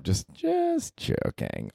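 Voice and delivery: squeaky high, soft "just joking"; sing-song backpedal; mock joking. Real, not acted. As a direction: brush it off in a tiny falsetto. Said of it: "I'm being too hard on myself".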